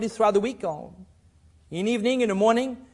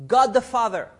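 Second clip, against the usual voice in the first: ordinary delivery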